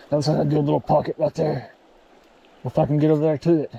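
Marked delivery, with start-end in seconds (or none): none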